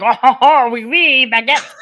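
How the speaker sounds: put-on zombie growling in a French accent; loud, hammed-up French accent. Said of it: "they were growling in a French accent".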